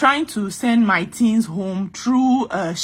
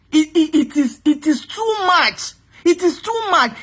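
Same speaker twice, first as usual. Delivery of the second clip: real exasperation; 5 semitones higher than usual. Real, not acted. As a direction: let fed-up frustration stretch the words upward. Venting exasperation at being lectured.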